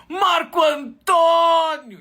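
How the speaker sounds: very high, loud, slow shout of his name. Acted. Passengers on the bus shouting his name high and loud. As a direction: cheer like a crowd.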